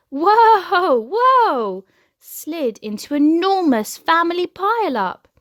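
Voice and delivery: loud high whoas, falling; tumbling cries as everyone slides; loud whoa pile-up. A narrator's reading. Family slips on floor.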